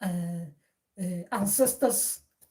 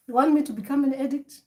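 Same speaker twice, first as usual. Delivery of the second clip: ancestor's voice, a bit higher and louder; ancestor speaking through him; 4 semitones higher, 6 dB louder, faster than usual. Acted. Ancestor challenging the listener in a firmer voice. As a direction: speak as the ancestor, pressing the point. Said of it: "they talk like this".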